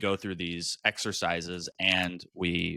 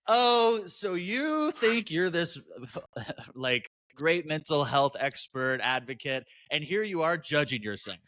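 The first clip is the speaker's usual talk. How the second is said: much higher, sarcastic inner critic; mocking self-scolding; 7 semitones higher than usual. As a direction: sneer at yourself in a high mocking voice. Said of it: "and then going".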